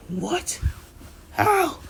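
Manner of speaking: very high squeaky disbelief; shocked gasp. Acted. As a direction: yelp it in amazement. Everybody shocked at throw.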